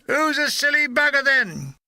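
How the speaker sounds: high, cheeky character voice; cheeky comrade. A narrator's reading. Departing gunner grinning back at the dramatic farewell, cheeky. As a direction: call back teasingly through a grin. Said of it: "Ben Wenham grins back and says".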